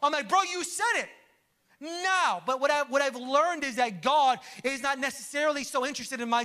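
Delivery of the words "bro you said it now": high, exasperated retold complaint; impatient protest. Preacher retells his demand to God, impatient and high. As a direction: protest loudly, higher than usual.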